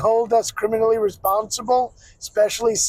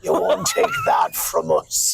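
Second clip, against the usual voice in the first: mock defiant shout.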